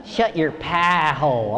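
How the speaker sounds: slow, low Southern drawl with a lazier vowel; Kentucky accent. Retold or imitated. Wife repeating her line, slow Kentucky drawl. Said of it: "it's the accent that gets you".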